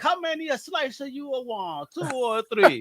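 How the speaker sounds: high-pitched imitation Italian pizza man; pizza-shop Italian accent. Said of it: "you sound like homie from the pizza shop".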